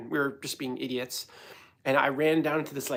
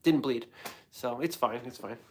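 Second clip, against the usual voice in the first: ordinary talk, nothing marked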